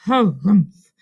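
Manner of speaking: loud, huffy harumph; disgruntled snort of a word; grumpy muttering. A narrator's reading. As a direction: huff it out as a grumble. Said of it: "muttered Rabbit".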